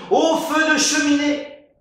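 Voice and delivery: high, loud shout of alarm. Acted. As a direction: yell it like a fire alarm.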